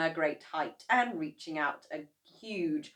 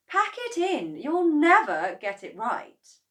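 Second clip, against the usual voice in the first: fed-up wife; 10 semitones higher, 10 dB louder than usual. A narrator's reading. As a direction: tell them to quit. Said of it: "Missus Cranky said".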